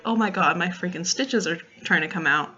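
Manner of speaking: ordinary talk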